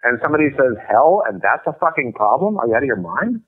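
incredulous rant. Real, not acted. Ranting at offended readers.

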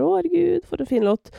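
high-pitched "oh" easing back down; a touch of acted, delighted admiration; mildly gushing reaction. Listener imagining her reaction to a moving song performance, mildly gushing.

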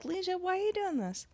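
a mock-pleading friend who is fed up; exasperated plea. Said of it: "I'd be like".

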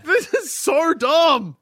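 high, slow, loud shout of disbelief; exasperated shout. Real, not acted. Exasperated outburst about Hogwarts spell.

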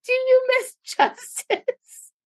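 slow, high mock question; imitating a producer. Mimicking the producer asking Vivi, slow and high. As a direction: stretch it out slowly, high and teasing. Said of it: "He goes".

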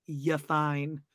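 slow, drawn-out soothing; mock reassurance. Christine reassuring Robin once again, slow mock soothing. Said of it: "having to reassure Robin once again".

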